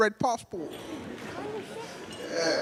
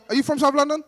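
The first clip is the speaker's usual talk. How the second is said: ordinary talk, nothing marked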